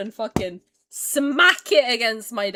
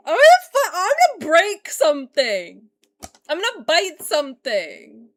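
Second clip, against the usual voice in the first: high, loud burst easing off; explode, then stretch out the threat; frustrated outburst; 6 semitones higher, 9 dB louder than usual. Real, not acted. Streamer losing it over the game, frustrated outburst.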